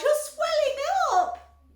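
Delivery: slow, high loud scream. A narrator's reading. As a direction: scream in horror. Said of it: "screamed Missus Beauregard".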